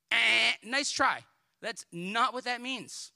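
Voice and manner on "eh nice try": loud, slow, mock-unimpressed "Eh, nice try"; slow and loud, dripping with sarcasm; sarcastic dismissal. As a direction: brush it off with a slow scoff. Dismissing a misread verse.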